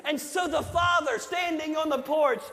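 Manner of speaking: fiery sermon build-up; preaching at full force. Acted. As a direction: preach it out to the whole church. Preaches the prodigal son.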